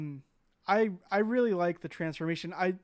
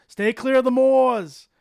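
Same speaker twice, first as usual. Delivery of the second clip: slow, loud, high mock warning from a pub local; ominous local warning; 6 semitones higher, 11 dB louder, slower than usual. Retelling the movie's pub locals to friends, hammed-up warning. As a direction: drag it out like a spooky villager. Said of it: "as they always say".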